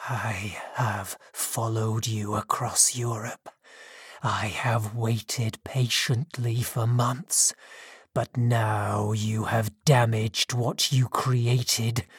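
low, slow, menacing whisper; slow angry whisper. A narrator's reading. Monster threatening his creator, low slow angry whisper. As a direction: hiss it out slowly with barely held anger. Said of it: "He whispered in a slow, angry voice".

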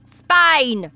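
father answering from the car; brusque father. Acted. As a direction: snap it out curtly. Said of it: "her father said".

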